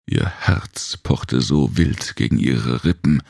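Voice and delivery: ordinary delivery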